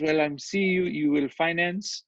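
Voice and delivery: light imitation of a cute Haitian French accent; a touch of Haitian accent, gentle and polite. Landlord imitating a Haitian buyer asking the price, lightly Haitian. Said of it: "cutest little Haitian French accent".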